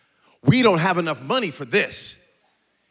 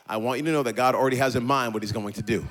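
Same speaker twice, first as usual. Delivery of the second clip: normal talking voice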